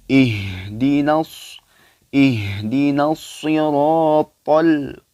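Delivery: Quran recitation. Acted. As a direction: chant the verse slowly like tilawah. Recites verse. (bacaan tilawah pelan dan lantang)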